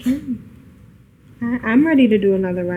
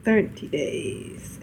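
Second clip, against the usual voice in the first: slow, unvoiced murmur of the number; whispered echo; 7 dB quieter, much slower than usual. Real, not acted. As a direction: breathe the words back slowly. Echoing the correction.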